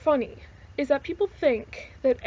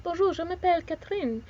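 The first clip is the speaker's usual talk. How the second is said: higher-pitched, flowing French accent; French accent demo; 5 semitones higher than usual. Shown as a demonstration. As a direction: introduce yourself in accented French. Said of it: "speak French with the accents".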